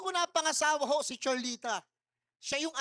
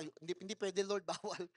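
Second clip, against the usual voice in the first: hushed pleading refusal; 7 semitones lower, 6 dB quieter than usual. Retold or imitated. Refusing God's call on plane.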